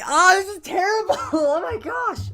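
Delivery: very high, drawn-out wail of dismay; exasperated outcry. Real, not acted. Despairing over the voice choice, high exasperated wail. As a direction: wail it high, breaking into laughter.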